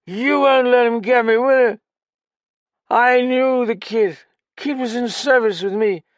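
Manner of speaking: high, loud, slurred, desperate; drunk outburst. Acted. Drunk pounding the table to confess, loud and slurred. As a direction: blurt it all out.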